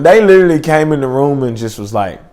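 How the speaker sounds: ordinary delivery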